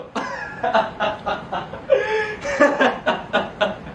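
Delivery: long high burst of laughter; mocking laugh. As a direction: derisive stage laughter. Mocks audience for coming to watch.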